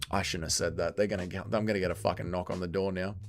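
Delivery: real sheepish, very soft aside; rueful undertone. Real, not acted. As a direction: drop the voice right down, as if afraid of being overheard. Regrets risky remark, fears a knock.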